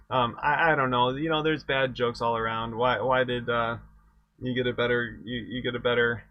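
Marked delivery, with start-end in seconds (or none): none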